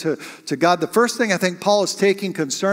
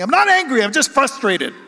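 high, loud defensive protest; re-enacted exasperated outburst; angry denial; 7 semitones higher, 4 dB louder than usual. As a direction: insist hotly that you are calm. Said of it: "You're getting angry".

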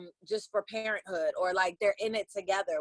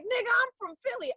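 imitating her, much higher; high-pitched quote; 13 semitones higher than usual. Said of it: "when she was like".

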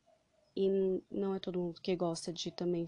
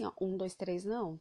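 fast, rattled-off count; rushed counting. Shown as a demonstration. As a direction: count far too quickly. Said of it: "esses três segundos não é assim".

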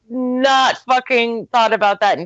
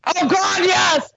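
high excited shout; 10 semitones higher, slower than usual. Real, not acted. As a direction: burst out delighted. Excited about Velvet Jones.

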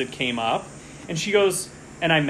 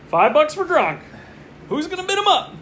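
much higher, louder auction call; excited bidding call; 13 semitones higher, 5 dB louder than usual. Real, not acted. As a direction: shout the bid out with a laugh. Calling out an auction bid.

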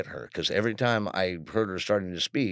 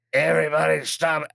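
loud, higher barked order; mock command; 9 semitones higher, 5 dB louder than usual. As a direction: shout it like taking over the scene. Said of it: "He would have been like".

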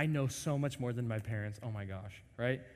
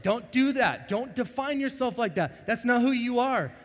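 loud, high urgent plea; God warning a wayward child; 10 semitones higher, 8 dB louder than usual. Retold or imitated. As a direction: call out to stop them.

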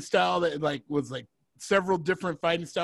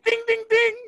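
high, loud boxing bell call; fight bell imitation; 5 dB louder than usual. Fight bell between Marta and Yolanda.

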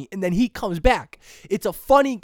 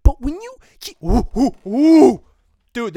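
ordinary delivery

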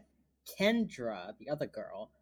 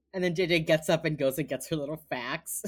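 ordinary talk, nothing marked

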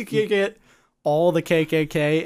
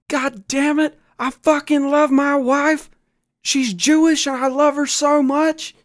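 higher, louder, over-the-top gushing; gushing prank caller; 7 semitones higher, 3 dB louder than usual. Pretend. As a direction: pile it on like a thrilled fanatic. Prank caller persona to Klan.

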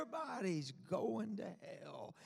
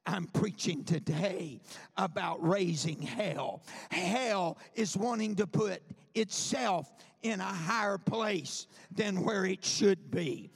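full-force sermon, louder and driving; fiery preaching to the congregation; 6 dB louder than usual. Acted. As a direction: preach it to the rafters.

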